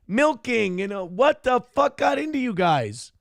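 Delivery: exasperated rant. Real, not acted. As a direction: rant at the chat in mock despair. Host exasperated by lewd chat.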